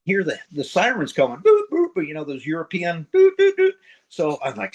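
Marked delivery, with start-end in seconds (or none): 1.4-2.1 high-pitched mimicked siren boops; siren noise. Telling buddy about sirens coming, mimicking them high. Imitating European sirens approaching. 3.4-3.7 high-pitched mimicked siren boops; siren noise. Telling buddy about sirens coming, mimicking them high. Imitating European sirens approaching.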